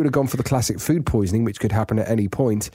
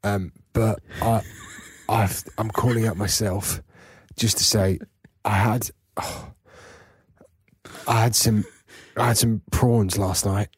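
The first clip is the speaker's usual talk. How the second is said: poorly Cockney caller; 3 semitones lower, 3 dB quieter than usual. Acted. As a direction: confess illness feebly in put-on Cockney. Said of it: "turned into some sort of Cockney East Ender".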